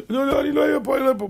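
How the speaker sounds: scared promising. Acted. Terrified man promises to leave.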